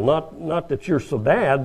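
normal talking voice

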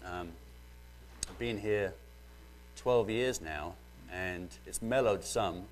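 normal talking voice

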